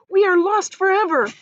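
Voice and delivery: high, loud despair; despairing ladybug. Acted. Ladybug despairing at the sharks, high cry. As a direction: cry it out hopelessly.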